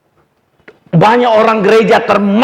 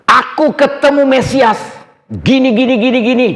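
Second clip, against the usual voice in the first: higher, eager mock announcing; excited cry; 7 semitones higher than usual. Samaritan woman telling her town, excited call. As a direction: call out the news like a thrilled villager. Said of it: "dia teriak".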